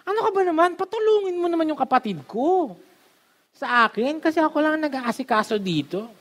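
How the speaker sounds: high, exasperated scolding of Jesus; shrill-pitched mock nagging; annoyed complaint. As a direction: whine it out like an overworked sister who has had enough. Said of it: "nagalit pala si Martha".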